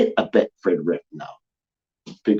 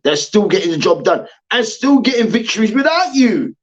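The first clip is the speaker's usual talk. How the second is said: ordinary talk, nothing marked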